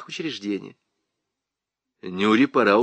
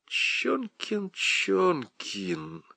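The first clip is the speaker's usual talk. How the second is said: drawn-out, softer musing; painfully recalling; 7 semitones higher, 8 dB quieter, much slower than usual. A narrator's reading. Lieutenant pretends to recall the prisoner's name, drawn-out musing. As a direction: stretch the name as if searching memory. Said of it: "как бы мучительно припоминая".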